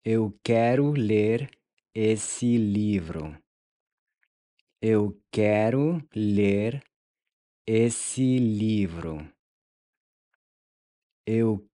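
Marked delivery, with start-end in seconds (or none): 0.0-9.2 "Eu quero ler" rising, then a slow "esse livro"; slow split phrase. Shown as a demonstration. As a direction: split for repeating, second half slow. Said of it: "How would you say". ("Eu quero ler", pause, slowly "esse livro")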